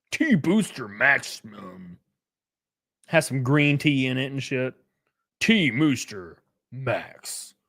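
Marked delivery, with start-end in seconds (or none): 0.1-2.0 mock ad voice. Reading product name like an ad. 5.4-7.5 mock ad voice. Reading product name like an ad.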